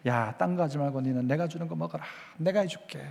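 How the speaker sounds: put-on soft, low, coaxing like a mother feeding her child; tender motherly voice. Pastor voicing the Lord as a mother to a tired daughter, soft and tender. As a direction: hush it, like mom saying eat what I give you.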